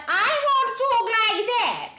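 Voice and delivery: high, exaggerated posh put-on; comic high posh parody; adopted posh voice. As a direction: announce it in a daft high posh voice. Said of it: "tomorrow I decide".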